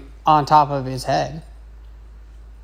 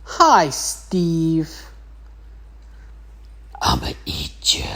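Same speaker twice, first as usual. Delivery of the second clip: menacing monster; much slower than usual. Retold or imitated. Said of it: "She's like".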